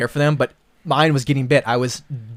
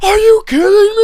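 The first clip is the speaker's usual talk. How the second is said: high, slow and loud, outraged disbelief; mock disbelief; 8 dB louder than usual. Coworkers at work shocked by the question, high slow disbelief. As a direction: stretch it out, shocked. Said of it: "You guys are like".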